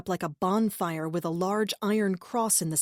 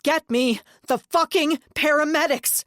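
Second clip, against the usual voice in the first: high, loud frantic scream; 9 semitones higher, 8 dB louder than usual. A narrator's reading. Narrator screaming for paramedics for her partner. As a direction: scream for help. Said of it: "I screamed".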